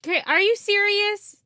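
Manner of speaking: high, loud disbelief; can't believe what she heard; incredulous. Real, not acted. Incredulous at disease wish.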